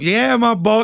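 high, loud egging-on; riling him up. Retold or imitated. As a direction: goad him with a hyped-up shout. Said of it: "riling him up".

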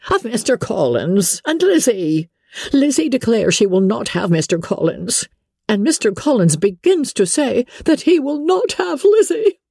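high, exasperated matron's voice; agitated mother. Acted. Wife explaining the marriage crisis to her husband, agitated.

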